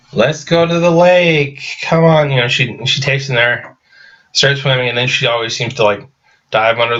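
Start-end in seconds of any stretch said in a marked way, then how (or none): 0.1-2.3 higher, slower coaxing lure in a put-on voice; luring shark girl. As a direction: tempt them toward the lake, sing-song and eerie. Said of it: "She's like".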